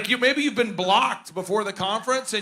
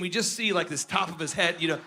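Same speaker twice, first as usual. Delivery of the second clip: ordinary talk, nothing marked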